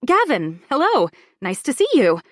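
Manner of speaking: loud, bright social greeting; brightly. A narrator's reading. Gallery owner greets a visitor, loud and bright. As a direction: greet him with upbeat brightness. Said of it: "I say this brightly".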